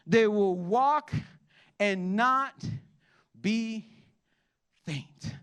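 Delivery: slow, drawn-out words that fade to a hush at the end; building scripture reading. Acted. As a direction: preach the promise, then let it die away. Preacher reads Isaiah to congregation.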